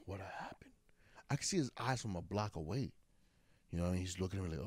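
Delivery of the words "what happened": worried whisper. Retold or imitated. Retold worried question.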